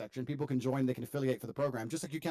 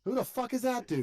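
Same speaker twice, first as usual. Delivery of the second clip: who-was-that outrage; incredulous; 8 semitones higher than usual. Real, not acted. Outraged after guest leaves.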